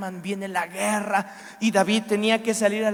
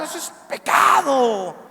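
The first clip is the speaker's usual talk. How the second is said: loud, higher acted shout of condemnation; enojado; 4 semitones higher, 7 dB louder than usual. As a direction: thunder it as a mock-outraged judge. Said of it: "David estaba enojado". (grito de enojo actuado)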